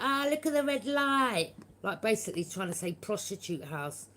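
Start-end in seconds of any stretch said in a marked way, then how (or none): 0.0-1.5 mocking neighbours. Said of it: "and they were like".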